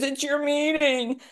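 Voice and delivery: high, slow, strained monotone, imitating a husband barely able to talk; mimicked struggle to speak, words stretched; imitating labored speech. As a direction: push each word out with effort, held high. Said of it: "he could hardly talk".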